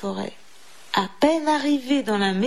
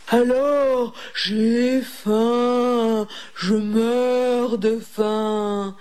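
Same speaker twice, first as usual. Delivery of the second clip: hungry wolf's cry; much slower than usual. A narrator's reading. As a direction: bellow hungrily as the wolf, dragging the words. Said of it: "s'écria le loup".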